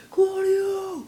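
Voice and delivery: mimicking a stranger yelling for the singer; imitating a fan's yell. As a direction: shout it like another fan in the crowd. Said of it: "someone else over there was like".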